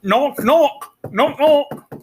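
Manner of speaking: voiced knocking, high and loud; knock sounds. Dorothy knocking loudly at the farmhouse door.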